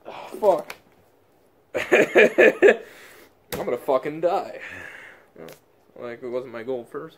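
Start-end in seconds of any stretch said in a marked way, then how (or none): 1.7-2.8 wheezy breathless laugh. Real, not acted. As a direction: laugh through the coughing. Laughing after choking on water.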